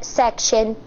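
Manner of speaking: ordinary delivery, nothing marked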